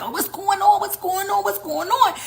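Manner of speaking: high alarmed voices asking over and over; panicked onlookers. Retold or imitated. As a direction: play startled bystanders after a blast. Commotion after explosion.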